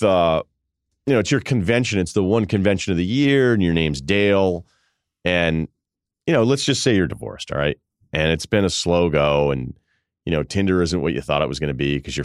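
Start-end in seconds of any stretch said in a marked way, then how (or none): none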